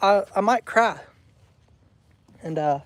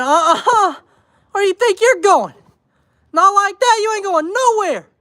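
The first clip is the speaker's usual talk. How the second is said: higher, louder mock scold; sassy scolding; 9 semitones higher, 5 dB louder than usual. Wife stopping a husband sneaking out, mock sassy scold higher and louder. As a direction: stop someone at the door with attitude. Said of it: "and said".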